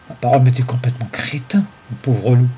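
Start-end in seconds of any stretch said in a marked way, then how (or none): none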